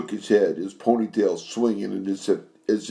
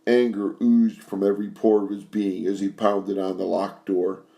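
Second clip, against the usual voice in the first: usual speaking voice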